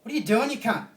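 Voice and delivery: high loud rude jab; Australian brushing off a stranger; mock Aussie abuse. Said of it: "you say".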